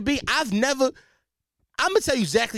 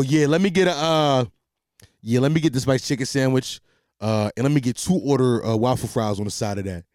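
put-on deep voice, much lower than usual; 7 semitones lower than usual. Shown as a demonstration. Customer ordering at a chicken counter, in a deep voice. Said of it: "I put the deep voice on".

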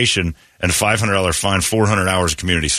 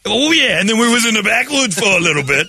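loud hammy sheriff; 13 semitones higher, 3 dB louder than usual. Acted. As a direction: belt it out like a lecherous sheriff. Voicing sheriff.

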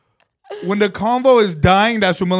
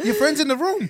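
retold dog-owner voice; 7 semitones higher than usual. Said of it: "she's like".

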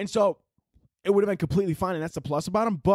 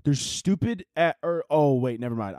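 usual speaking voice

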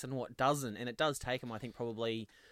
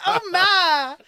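swooning, high, loud melodrama; hammed-up damsel in distress; Scarlett O'Hara; 13 dB louder, much slower than usual. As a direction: wail it like a helpless Southern belle, slowing at the end. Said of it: "Like he's Scarlett O'Hara".

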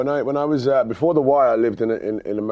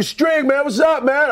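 much higher, louder American fan call; fan's American greeting; 6 dB louder, slower than usual. Retold or imitated. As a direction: shout it like an excited American fan. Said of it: "people would come up to me like".

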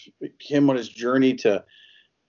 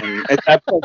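ordinary delivery

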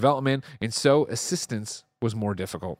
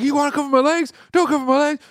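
high, loud mock rant; imitating mad yell; 7 dB louder than usual. Football player yelling at the interviewer, mock furious. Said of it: "he was, uh, so mad".

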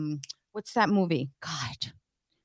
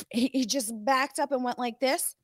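usual speaking voice

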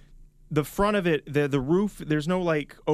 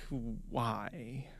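exasperated; 6 semitones lower, 13 dB quieter than usual. Retold or imitated. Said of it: "you just think".

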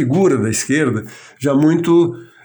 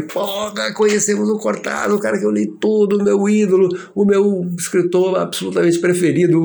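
excited fan; 7 semitones higher than usual. Retold or imitated. Thrilled fan after meeting idol.

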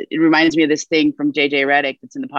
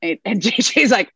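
ordinary talk

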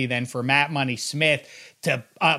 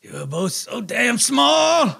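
slow, higher hammy dying father; overacted death scene; 10 semitones higher than usual. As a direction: drag it out like a dying dad. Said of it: "I'm gonna be Harry Dean".

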